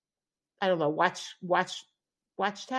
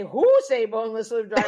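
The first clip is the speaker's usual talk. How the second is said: louder imitated broken-English line; mock catchphrase; 6 dB louder than usual. Friends repeating the restaurant man's line, mock-accented. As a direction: ham up the man's line for fun. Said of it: "we used to always go around and say".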